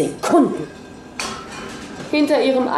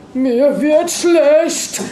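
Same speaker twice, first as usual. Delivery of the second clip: high, very slow, nauseated voice; about to be sick, drawn out; breathed out queasily; 6 semitones higher, 3 dB louder, much slower than usual. A narrator's reading. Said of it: "hauchte Maria". (langsam, hoch, als würde ihr übel)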